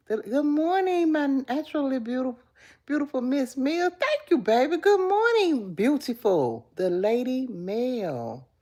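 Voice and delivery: high, loud gushing hello; gushing greeting. Real, not acted. Greeting her live chat fans, high and gushing. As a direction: greet each fan with delight.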